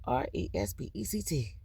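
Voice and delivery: R E S P E C T spelled letter by letter, softly. Shown as a demonstration. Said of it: "in all capital".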